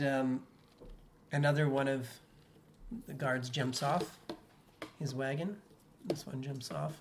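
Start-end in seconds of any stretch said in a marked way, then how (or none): none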